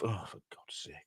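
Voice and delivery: under-breath frustrated whisper; whispered exasperation. Real, not acted. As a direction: mutter it to yourself. Muttered frustration aside.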